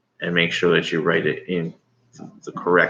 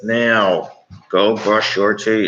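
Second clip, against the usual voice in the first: firm parent command; 3 semitones higher, 4 dB louder, much slower than usual. Real, not acted. Mom telling kid to brush teeth.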